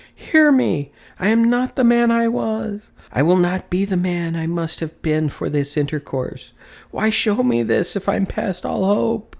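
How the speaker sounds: cling and beg as if for his life; desperate cry. Acted. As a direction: Scrooge clutches the ghost's robe, crying out desperately. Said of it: "he cried, tight clutching at his robe".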